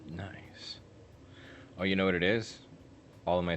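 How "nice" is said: soft whispered nice. Real, not acted. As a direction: breathe it out, no voice. Whispered reaction.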